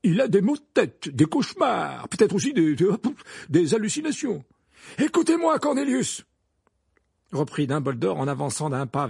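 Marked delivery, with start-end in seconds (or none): 0.0-4.4 high, insistent, stumbling list; dismissive argument. Acted. Fudge argues, groping for explanations. 5.0-6.2 high, loud, urgent demand; commanding wizard headmaster. Acted. Dumbledore confronts Fudge.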